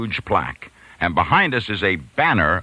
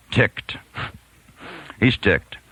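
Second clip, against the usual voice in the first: normal talking voice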